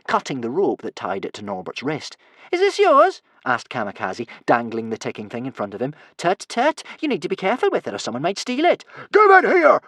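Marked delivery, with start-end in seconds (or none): 2.5-3.2 very high, loud mock question; taunting. A narrator's reading. Kamikaze dangling the stolen thing, high loud taunt. 6.2-8.9 high, quick mock advice; taunting. A narrator's reading. Kamikaze taunting Norbert with the ticking thing, high quick teasing. 9.1-9.8 high, loud furious roar. A narrator's reading. Norbert demanding his ticking thing back, furious roar. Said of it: "roared Norbert".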